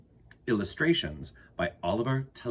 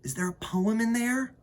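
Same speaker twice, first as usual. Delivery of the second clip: put-on curious child; 13 semitones higher than usual. Arthur searching cabinet.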